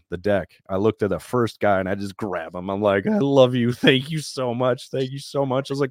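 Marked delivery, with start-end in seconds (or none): 3.1-5.7 raised, emotional outpouring of thanks; effusive gratitude. Retold or imitated. Rescued rower thanking the first sailor on deck, effusively grateful. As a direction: relive blurting thanks to your rescuer, pitched up.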